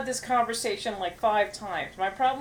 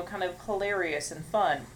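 normal talking voice

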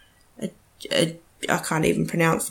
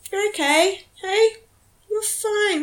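talking to the dog. Real, not acted. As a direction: coo gently to a small dog. Soothing the hiccuping dog.

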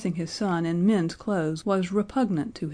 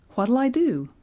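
high, loud, helpless question; flustered appeal for help; desperate; 6 semitones higher, 5 dB louder than usual. A narrator's reading. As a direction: ask in a mild panic. Flustered father asks the nurse desperately.

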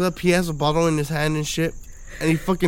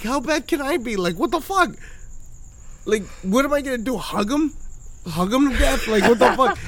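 high, incredulous protest; 6 semitones higher than usual. Retold or imitated. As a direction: raise the voice in disbelief. Retold own incredulous protest.

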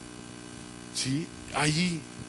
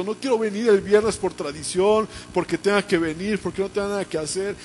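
fervent prayer; 4 semitones higher than usual. Acted. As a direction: lead the congregation in an impassioned plea. Models prayer for congregation. (oración suplicante, apasionada, en tono alto)

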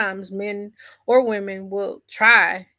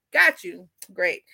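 ordinary delivery

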